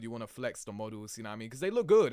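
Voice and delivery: normal talking voice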